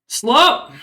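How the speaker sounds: short, high, loud shout; loud, high-pitched call; guard's shout. Acted. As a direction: yell it out like a guard serving prisoners. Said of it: "as he shouts in Dwarvish".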